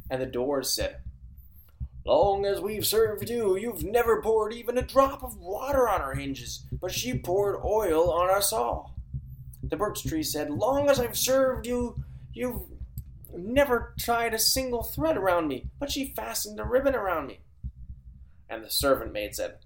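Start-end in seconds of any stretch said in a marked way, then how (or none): 2.1-9.0 slow, high creaky-door whine; drawn-out grumbling doors; high complaining doors. Acted. The doors complain to Baba Yaga. 10.5-17.4 high complaining birch tree. Acted.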